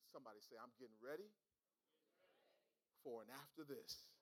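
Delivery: low, soft, with long pauses; quiet, deep-pitched pulpit murmur; hushed preaching. Real, not acted. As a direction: let each phrase sink in quietly.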